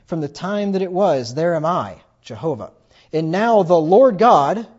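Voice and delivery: scripture reading. Acted. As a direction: declaim the verse like the voice of God.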